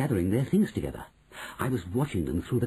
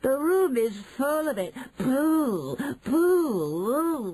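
high, wailing cry of disgust; shouting; 3 dB louder, much slower than usual. Acted. Witches revolted by the stink, high wailing shouts. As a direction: wail it high, stretching the last word. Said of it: "they shouted".

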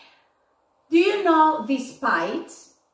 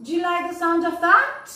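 "Do you like the sound of that?" higher, rising on that; rising intonation; 6 semitones higher, faster than usual. Shown as a demonstration. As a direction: echo the recording with a lift on that. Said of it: "Do you notice the intonation?".

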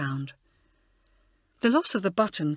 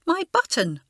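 much higher, surprised cry; exclaiming; 10 semitones higher, 4 dB louder than usual. A narrator's reading. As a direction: blurt it out in surprise, high. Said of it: "she exclaimed".

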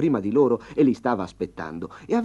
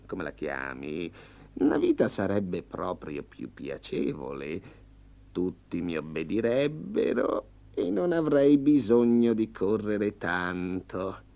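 tiger voice; 2 semitones lower, slower than usual. Acted. Tigre voiced in fable.

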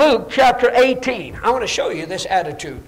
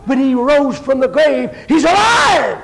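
raised, loud preacher's cry; shouted proclamation; 4 semitones higher, 4 dB louder than usual. Retold or imitated. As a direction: proclaim it, building to a high shout. Retelling his own shouted preaching.

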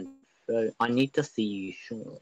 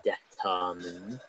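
mock scolding; 5 semitones lower, 7 dB quieter, slower than usual. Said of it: "here's what I have to say".

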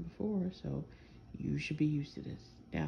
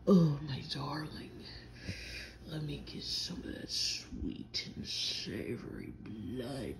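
sultry vampire, dropping low and slow into a whisper; low, slow whispered vampire croon; mock vampire audio; 5 semitones lower, slower than usual. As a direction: parody a seductive vampire ASMR. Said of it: "those, like, audios you listen to".

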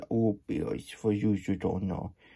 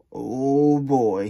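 usual speaking voice

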